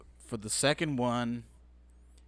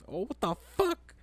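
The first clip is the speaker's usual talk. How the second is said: stunned realisation; 11 semitones higher than usual. Retold or imitated. Finding out she got pregnant so quick, acting stunned. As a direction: squeak it out in mock shock.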